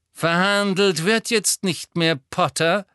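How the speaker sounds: smug, patronising demand; condescending villain. A narrator's reading. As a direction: talk down to a boy you have trapped. Malfoy gloats.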